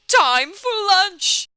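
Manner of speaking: high, slow, booming lunch call; shouted announcement. Acted. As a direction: holler it to the whole room. Teacher character announces lunch.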